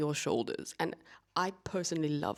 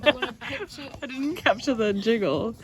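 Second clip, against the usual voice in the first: laughing; 4 semitones higher, 8 dB louder, slower than usual. Real, not acted. Laughing while filming cake.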